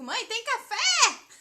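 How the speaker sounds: high, loud, slow call to mom, café stretched; morning call. Retold or imitated. Grown daughter calling her mother for coffee, loud. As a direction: holler for coffee first thing. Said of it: "de manhã eu digo".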